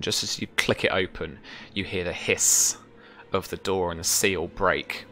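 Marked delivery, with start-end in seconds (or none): none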